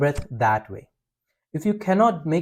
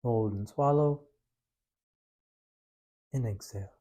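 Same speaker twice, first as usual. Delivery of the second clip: guided breathing; 3 semitones lower, 7 dB quieter than usual. Acted. Leads a breathing practice.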